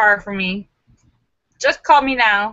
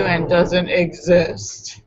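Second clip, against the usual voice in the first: slow, lower drawn-out payoff; slow punchline; 5 semitones lower, much slower than usual. Acted. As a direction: let the punchline land slowly. Joke punchline, slowed.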